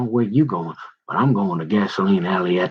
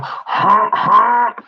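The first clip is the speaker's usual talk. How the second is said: high honking horn noise, a bit louder; honk imitation; 12 semitones higher, 4 dB louder than usual. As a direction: mimic a car horn, high. Imitating car horns.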